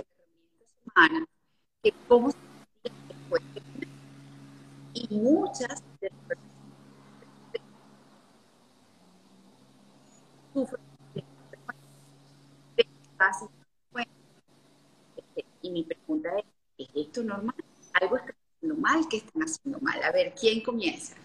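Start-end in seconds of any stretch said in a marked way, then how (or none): 0.0-1.3 breaking up over a bad line; words cutting out, dropped audio; choppy connection. Real, not acted. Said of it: "la estoy escuchando entrecortada". 1.8-3.9 audio dropping out mid-sentence; garbled, cutting out over the call; choppy connection. Real, not acted. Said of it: "la estoy escuchando entrecortada". 4.9-18.3 breaking up over a bad line; words cutting out, dropped audio; choppy connection. Real, not acted. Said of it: "la estoy escuchando entrecortada".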